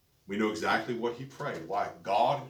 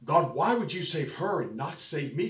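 mildly heated, higher-pitched protest; retold angry complaint; 6 semitones higher, 4 dB louder than usual. Preacher voices young woman questioning God, mildly angry. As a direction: imitate her indignant plea to God. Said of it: "I went home just angry".

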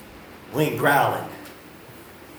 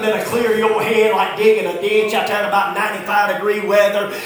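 loud, raised country-preacher cadence; full-force preaching; 5 semitones higher, 5 dB louder than usual. Real, not acted. Preacher exhorting congregation about hard work, full-force preaching. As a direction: preach it hard, punching key words.

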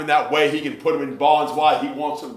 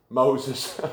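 usual speaking voice

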